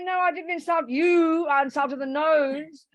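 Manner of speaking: high, loud, insistent mock explanation; mock protest from the insulter. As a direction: protest innocence in a hammed-up way. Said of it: "And you'll say".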